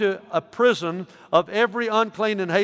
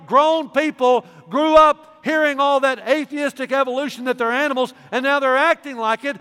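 rising indignation; 6 semitones higher than usual. Real, not acted. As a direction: build the rebuke to a ringing peak. Rising rebuke building to climax.

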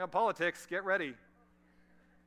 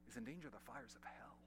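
dropped to hushed; 7 semitones lower, 17 dB quieter than usual. Acted. Solemn warning after loud build.

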